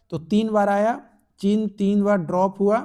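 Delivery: ordinary delivery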